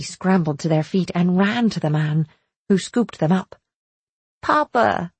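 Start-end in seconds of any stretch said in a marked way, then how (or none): 4.4-5.1 higher, glad call to a father; children's happy cry, acted in narration; excited cry. A narrator's reading. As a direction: call out to Papa like an eager child. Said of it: "they cried".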